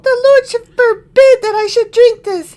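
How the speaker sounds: high, loud prissy exclaim. Acted. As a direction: whine it like a pampered king. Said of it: "I did the wrong voice".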